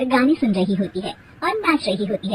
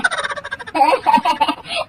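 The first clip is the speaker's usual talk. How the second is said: acted laugh; 8 semitones higher than usual. Grandmother laughs at herself.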